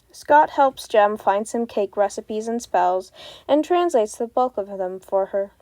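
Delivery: normal talking voice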